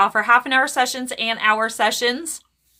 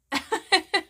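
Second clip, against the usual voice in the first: light breathy giggle; stifled, tickled laugh; amused giggle; 6 semitones higher, 5 dB quieter than usual. Real, not acted. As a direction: giggle at what you just heard. Amused at what spirit said.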